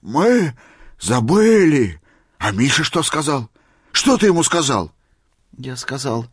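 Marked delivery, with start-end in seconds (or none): 0.0-2.0 high, loud, drawn-out repeat; astonished echo. A narrator's reading. Old man struck by the sailor's confession, astonished. 2.4-4.9 raised, insistent questioning; eager demand. A narrator's reading. Old man wanting Misha's reply, eager.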